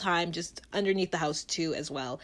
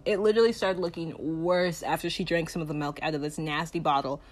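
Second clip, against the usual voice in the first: usual speaking voice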